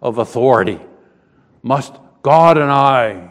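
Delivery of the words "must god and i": loud, slow, lordly; self-important. Shown as a demonstration. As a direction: stress "God" with puffed-up authority. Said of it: "puts himself up in a position of authority".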